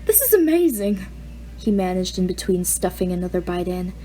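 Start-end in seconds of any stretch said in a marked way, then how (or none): 0.0-1.1 a lightly voiced eager teenager, higher; boy asking in awe; nervous teen. A narrator's reading. Peter's teen voice.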